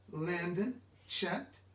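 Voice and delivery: usual speaking voice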